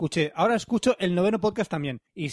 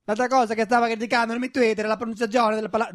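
botched foreign-sounding attempt at Mexican accent; badly faked Mexican accent; failed Mexican accent; 3 semitones higher than usual. Host retrying the email in a failed Mexican accent. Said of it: "no, tampoco es mexicano".

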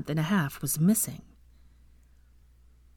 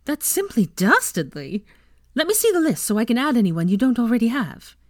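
impressed; 6 semitones higher, 3 dB louder than usual. A narrator's reading. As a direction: savour it, then chatter eagerly higher. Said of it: "Ginny looked deeply impressed".

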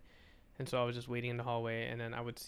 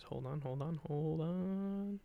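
softer, last words drawn out and rising; distracted stalling; 4 semitones higher, 6 dB quieter, slower than usual. Real, not acted. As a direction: stall while searching, stretching the final one. Stalling while searching the phone.

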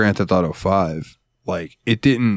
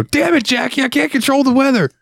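very high-pitched mock shout; outburst catchphrase. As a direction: burst out in exaggerated exasperation. Randomly bursts out a catchphrase.

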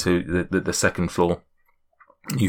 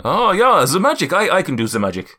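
put-on high, louder opening in the giant's accent; Zephyros; 6 semitones higher, 4 dB louder than usual. Giant claiming his own sorcery, high accented voice. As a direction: pipe up high, then settle. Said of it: "my accent's just all over the place".

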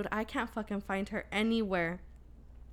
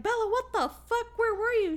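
retold yell; 11 semitones higher than usual. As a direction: yell it out high at the kid who vanished. Mother finds missing daughter.

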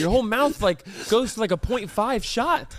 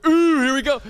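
excited; 5 semitones higher, 6 dB louder than usual. Real, not acted. Hyping up packing story.

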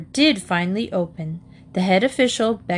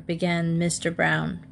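usual speaking voice

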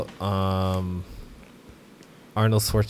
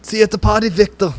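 higher-pitched action-hero catchphrase; Arnold; 9 semitones higher, 4 dB louder, faster than usual. Acted. Friend quoting an Arnold kill line, hammed-up Arnold impression. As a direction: call it out in a mock action-star voice. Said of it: "you'd like to see Arnold kill".

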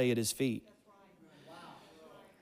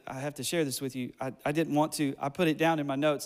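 ordinary delivery

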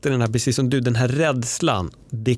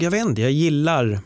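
normal talking voice

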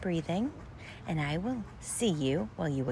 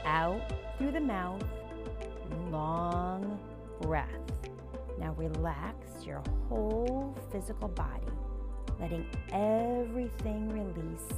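breathing coach; 3 dB quieter than usual. Acted. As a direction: guide the breaths calmly and softly. Leads guided breathing.

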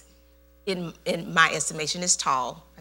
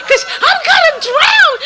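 mock panic; 12 dB louder than usual. Imitating panicked Lois.

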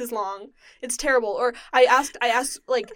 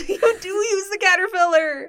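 laughing while talking; 7 semitones higher, 4 dB louder than usual. Real, not acted. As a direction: giggle through it. Laughing at friend.

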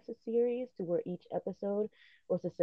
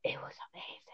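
whispered; 9 dB quieter, faster than usual. Real, not acted. Hushed awe at comic.

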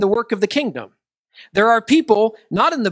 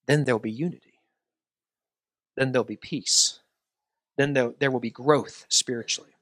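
earnest, softening prayer voice; 4 semitones lower, 3 dB quieter than usual. Acted. Pastor praying for the church, earnest and growing hushed. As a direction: pray it over them, drawing quieter toward the end.

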